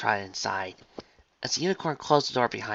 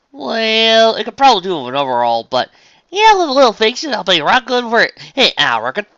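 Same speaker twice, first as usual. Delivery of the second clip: conceding southern farm pony; 12 semitones higher, 12 dB louder than usual. Acted. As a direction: concede it in a country twang. Voices Applejack, conceding.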